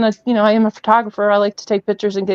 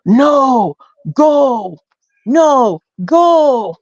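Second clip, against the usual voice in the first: mild imitation of Bigfoot shouting at them to leave; retold Bigfoot yelling; 4 semitones higher, much slower than usual. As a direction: bark the warning like a big creature chasing you off. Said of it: "he's yelling".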